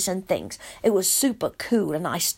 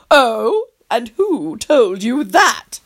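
arch retort; much slower than usual. Acted. Tony retorting.

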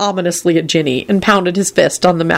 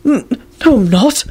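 high, faltering protest; mumbled denial; 6 semitones higher than usual. A narrator's reading. Neville denying he gossips, mumbling. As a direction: stutter the denial weakly. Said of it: "Neville mumbled quickly".

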